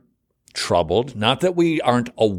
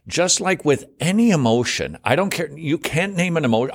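usual speaking voice

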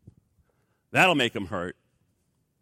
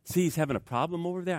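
ordinary delivery

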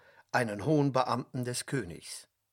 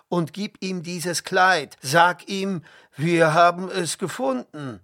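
higher, louder order to a servant; commanding; 4 semitones higher, 7 dB louder than usual. A narrator's reading. Brothers order the servant to bring the coat, commanding. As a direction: give firm orders. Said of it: "befahlen sie dem Knecht".

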